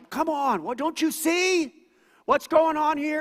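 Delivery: high, loud, fed-up complaint; hammed-up frustrated Martha yelling at Jesus; exasperated Martha. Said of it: "But she's like".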